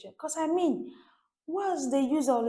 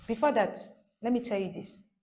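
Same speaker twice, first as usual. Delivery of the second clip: ordinary talk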